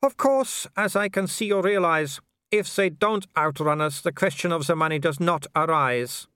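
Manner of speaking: haughty werewolf leader. Acted. Werewolf explains the game to his quarry, haughty. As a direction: play a foreign villain laying down terms.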